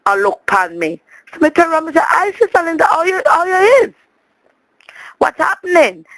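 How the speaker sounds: ordinary talk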